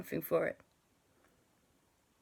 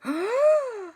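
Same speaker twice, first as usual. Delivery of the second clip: 1 gasp of surprise; 8 dB louder than usual. Acted. Characters gasp at sea monster.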